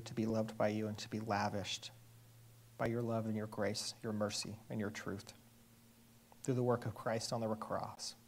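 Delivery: soft, hushed prayer voice. Acted. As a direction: pray quietly and reverently. Opening prayer before the reading.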